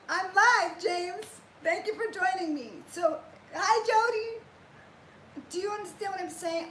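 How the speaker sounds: high, loud hellos to viewers; cheerful greetings. Real, not acted. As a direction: brighten up to greet the chat.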